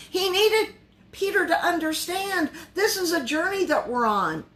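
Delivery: ordinary talk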